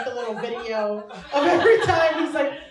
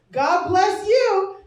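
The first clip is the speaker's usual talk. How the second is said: mock sweet blessing; 6 semitones higher, 9 dB louder, much slower than usual. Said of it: "she's like".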